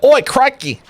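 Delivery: high, hammed-up Aussie outback bloke; over-the-top Australian professor voice; Australian accent. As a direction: play a Steve Irwin type offering a starter. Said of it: "went from Australian to something else".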